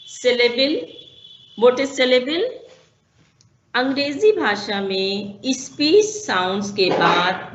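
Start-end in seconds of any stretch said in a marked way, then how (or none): none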